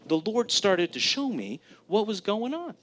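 ordinary talk

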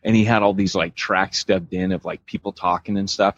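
usual speaking voice

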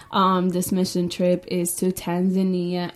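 ordinary talk, nothing marked

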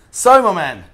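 booming hearty hello; loud greeting. Real, not acted. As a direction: call it out big and loud. Host greeting guest loudly.

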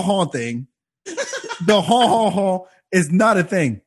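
usual speaking voice

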